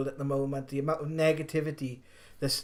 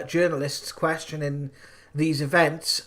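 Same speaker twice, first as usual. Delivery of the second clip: ordinary talk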